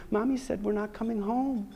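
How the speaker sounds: lightly voiced small boy, high and soft; young son. Son gives Dad news by phone, lightly childlike. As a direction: softly imitate a little child. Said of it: "speak to Jonathan".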